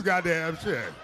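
normal talking voice

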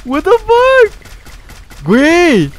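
high, loud, repeated cries of disbelief; shocked wailing. Real, not acted. Streamer stunned by the game, shocked wailing. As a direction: wail the same word in stunned shock.

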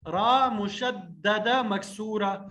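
slow, carefully spelled term; teacher stretching out the term; slow clear naming. Shown as a demonstration. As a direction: say each syllable deliberately. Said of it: "اس کو کہتے ہیں".

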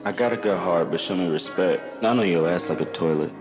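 ordinary talk

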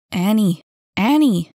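loud insistent call; urgent calling out. Acted. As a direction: call sharply to get through to her. Man calls to struggling girl.

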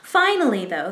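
ordinary delivery

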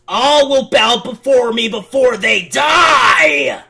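loud, slow villain roar; villain shout. Acted. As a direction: proclaim it like a tyrant. Villain character declaration, shouted.

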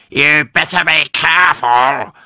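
put-on high old hag voice, warning; Missus Twit. A narrator's reading. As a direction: wag a finger in a high voice. Wife warning husband.

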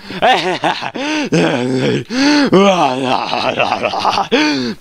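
long cackle in rising and falling waves; gleeful cackle. Real, not acted. Player who just trapped a pig, gleeful cackle. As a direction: cackle on and on in gloating triumph. Gloats over capturing the pig.

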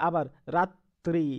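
রাত্রি said slowly as "rattri", the ত doubled; slow pronunciation demo. Shown as a demonstration. Teacher slowly models রাত্রি with the doubled ত. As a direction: stretch রাত্রি slowly so "rat-tri" shows its double ত. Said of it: "তটা কিন্তু দুইবার হয়ে যাচ্ছে".

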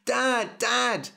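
high-pitched calls to dad; child shouting up. A narrator's reading. Daughter shouting up at her father, high eager calls. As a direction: call up eagerly like a little girl. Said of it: "she shouted up at him".

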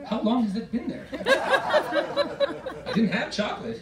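high, rising mock alarm; hammed-up squeamish shriek; disgusted panic. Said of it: "not go".

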